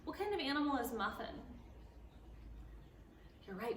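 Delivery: ordinary delivery, nothing marked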